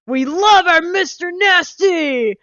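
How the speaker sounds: high, drawn-out shout of delight; hammed-up, roaring enthusiasm; imitating a loud pitch. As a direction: swell suddenly into a salesman's yell. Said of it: "he gets loud".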